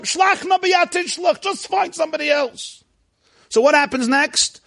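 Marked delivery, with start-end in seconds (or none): 0.0-2.8 begging shout. Acted. Moshe begging Hashem to send another, high shouted plea.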